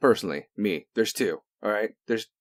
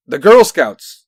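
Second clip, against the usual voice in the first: angry shout; 13 dB louder, slower than usual. Real, not acted. Ranting about Boy Scouts.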